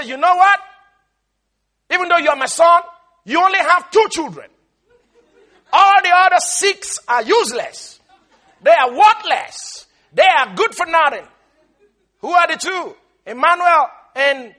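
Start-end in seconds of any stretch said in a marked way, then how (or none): 0.0-11.3 louder, higher grandmother's scorn; hammed-up grandmother dismissing kids; mock scolding. Grandmother telling dad the kids are useless, louder. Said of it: "She would look my dad and say".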